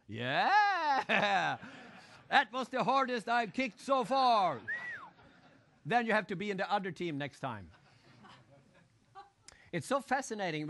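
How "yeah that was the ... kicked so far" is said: loud, laughing, slow "Yeah!" with a chuckle; mock cheerful laugh. Player laughing off a missed penalty, loud slow mock joy. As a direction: laugh off the miss as if proud of it. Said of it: "Very few in this situation go".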